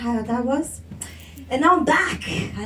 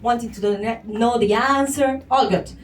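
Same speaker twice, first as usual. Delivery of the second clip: ordinary delivery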